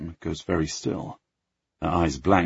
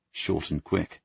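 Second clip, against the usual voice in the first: plain reading voice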